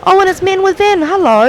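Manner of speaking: bright, high, delighted welcome; excited greeting. Real, not acted. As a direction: call out the name with glee. Greeting a listener on air.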